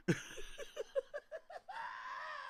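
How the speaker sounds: high shrieking laughter. Real, not acted. Hysterical laugh.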